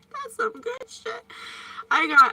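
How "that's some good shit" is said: high, breathy laughing whisper. Real, not acted. Savoring an aioli sandwich to viewers, laughing whisper. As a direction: giggle it out under your breath.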